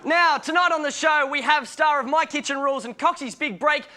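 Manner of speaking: ordinary delivery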